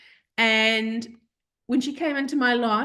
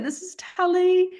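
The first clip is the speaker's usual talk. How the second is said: put-on sweet baby-talk voice; 9 semitones higher, 4 dB louder than usual. Introducing puppy in baby voice.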